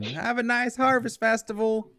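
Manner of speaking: high-pitched rat calling after travellers; half-yelled high farewell from an old rat; mild yell. Acted. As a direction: call it out behind them, high-pitched. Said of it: "rat kinda yells behind him".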